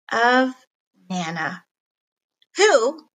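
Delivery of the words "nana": plain reading voice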